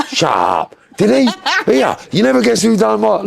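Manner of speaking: high, gasping gossip voice; mock Londoner gossip. Imitating Londoners passing on gossip, high and gasping. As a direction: ham up a nosy Londoner spreading rumours. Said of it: "That's what Londoners go".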